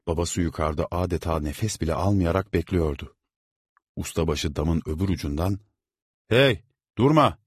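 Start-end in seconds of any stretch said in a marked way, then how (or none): 6.3-7.4 loud, high shout across the roof. A narrator's reading. Foreman shouting at the worker to keep hammering. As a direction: foreman yells to get back to work. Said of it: "diye bağırdı".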